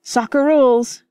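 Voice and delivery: high, loud rejoinder; retort. Acted. George retorts to Freddy mid-game, loud retort. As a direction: snap back the rule. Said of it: "George retorted".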